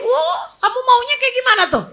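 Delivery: lightly acted high-pitched mother's retort; exasperated mother. Mother answering a complaining child, mock exasperated. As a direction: throw the challenge back, pitched high.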